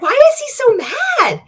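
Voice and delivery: loud disbelieving question to the viewers; exasperated outburst. Real, not acted. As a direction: throw it out in disbelief. Exasperated at the ranting clip.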